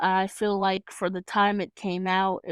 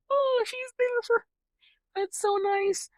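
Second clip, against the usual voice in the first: mock sobbing. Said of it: "And I sobbed".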